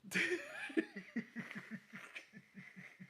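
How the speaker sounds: a long, high, drawn-out laugh. Real, not acted. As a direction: let a high laugh roll on. Laughing about the British friend.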